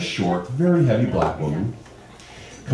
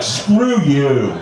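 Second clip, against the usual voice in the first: annoyed retort; 5 semitones higher, 5 dB louder than usual. Retold or imitated. Retorts to the youngster.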